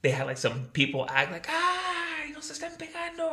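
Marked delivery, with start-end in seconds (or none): 1.5-3.3 very high, whiny fake cry; squeaky mock distress; mock wailing victim. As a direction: ham up a fake hostage. Fake victims acting beaten.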